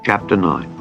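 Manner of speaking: ordinary delivery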